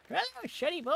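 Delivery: put-on high cackly old lady voice; sweet old granny, saying something rude; foul mouthed granny. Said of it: "talk to everybody in the old lady voice".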